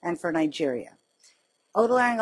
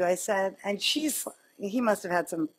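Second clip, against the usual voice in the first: ordinary delivery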